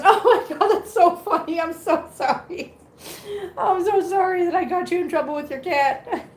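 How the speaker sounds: high voice, real laughter breaking in; amused, high-pitched and laughing; laughing apology. Real, not acted. Laughing at viewer's cat story.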